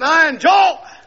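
"I enjoy it" shouted high and loud; preacher shouting "I enjoy it" in joy. Shown as a demonstration. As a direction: belt out "I enjoy it". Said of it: "Some of you endure it".

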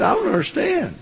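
high, mock-weepy complaint; imitated tearful lady. Preacher retelling a bewildered church lady, mock tearful. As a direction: plead in a high, put-on tearful voice. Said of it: "She started crying".

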